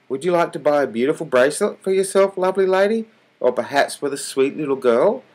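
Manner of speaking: butter them up with a sugary sales pitch; flattering. A narrator's reading. Salesman sweet-talking the old lady, flattering. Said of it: "in his best flattering voice".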